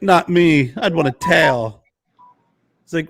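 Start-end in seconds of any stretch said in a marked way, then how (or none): 0.0-1.8 raised, louder quirky star voice; Christopher Walken impression. Walken answering his own question, punchy impression. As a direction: punch it in oddball staccato. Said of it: "he's like".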